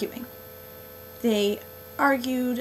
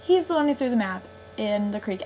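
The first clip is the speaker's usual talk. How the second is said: ordinary talk, nothing marked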